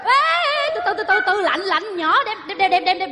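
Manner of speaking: high, rapid-fire repeated warnings; flustered urging. Acted. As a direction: fuss urgently as the baby is carried in. Hoảng khi bế em bé vào. (cuống quýt nhắc chừng, cao giọng)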